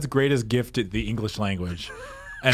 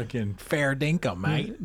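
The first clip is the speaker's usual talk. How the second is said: mock Australian, "mate" lifting up; cheeky Aussie slang; Australian accent; 2 semitones lower, 6 dB quieter than usual. Co-host doing a jokey Australian accent again. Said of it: "my Australian accent".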